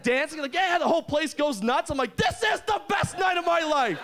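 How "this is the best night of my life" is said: high, laughing, overjoyed yell; ecstatic shout. Retold or imitated. Dancing in the glass cube, a high laughing yell. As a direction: shout it with pure joy.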